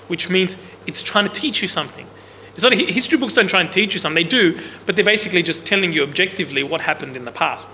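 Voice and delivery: ordinary talk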